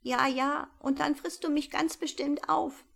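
high, wary mouse voice; suspicious little mouse; skeptical mouse. Acted. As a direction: mouse doubting the owl. Mouse distrusts owl.

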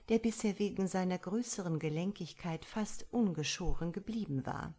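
ordinary delivery

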